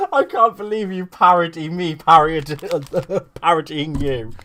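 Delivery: words broken up by giggles; loud, barely getting it out through laughter; laughing while talking. Real, not acted. Tickled by mutual parody.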